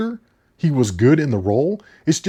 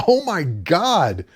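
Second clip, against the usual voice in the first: exasperated; 7 semitones higher than usual. Retold or imitated. Reviewer recalls reacting to an actress who won't leave the film, exasperated. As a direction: groan the words out in higher-pitched disbelief. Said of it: "I was like".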